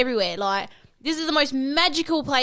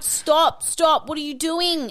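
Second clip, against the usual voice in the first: panicked pleading; 6 semitones higher, slower than usual. Retold or imitated. Girlfriend begging Aaron not to fight, frantic higher cry. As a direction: plead urgently for him to stop. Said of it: "and I'm like".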